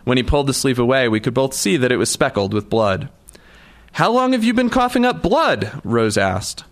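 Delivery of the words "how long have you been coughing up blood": much higher, alarmed woman's voice; high concerned female voice; Rose's voice. A narrator's reading. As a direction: ask it as the worried friend. Rose voiced in story dialogue.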